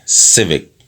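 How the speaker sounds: ordinary talk, nothing marked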